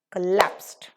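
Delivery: COLLAPSED with a loud punch on LAPSED; stressed second syllable. Shown as a demonstration. Teacher marks the clap on the L, heavy stress. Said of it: "The clap again is with L".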